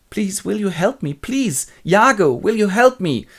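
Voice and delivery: high, anxious begging; pleading. Acted. Wife begs Emilia and Iago for help, pleading. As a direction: beg for help, hammed up.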